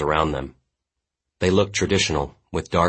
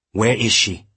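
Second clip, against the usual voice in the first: higher, pressing question; alarmed, raised-pitch question; urgent demand; 5 semitones higher, 4 dB louder than usual. A narrator's reading. Jundo demands where victim is.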